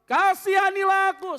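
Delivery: very high, loud, slow shout. Acted. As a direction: stretch out the cry for mercy. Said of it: "semakin keras ia berseru".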